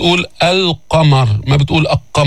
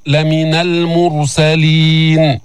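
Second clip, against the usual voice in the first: very slow chanted recitation; Quran recitation; much slower than usual. Shown as a demonstration. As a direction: stretch every long vowel. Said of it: "فخم الراء".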